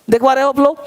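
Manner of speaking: ordinary talk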